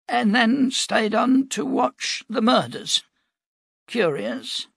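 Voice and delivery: put-on slow, high, dry old voice; old prophet, pointed. Cade needling the captain, dry old prophet. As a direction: let the suspicion hang.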